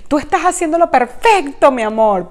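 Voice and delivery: alentadora. Retold or imitated. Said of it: "mi hermana le dice".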